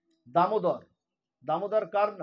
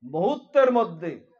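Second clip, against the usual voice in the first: louder, emphatic preaching, laughing in places; preaching at full force; 2 semitones higher, 6 dB louder than usual. Acted. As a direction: drive home the glory of the name. Preaching the glory of the name. (জোরালো প্রবচন)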